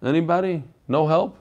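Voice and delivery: ordinary talk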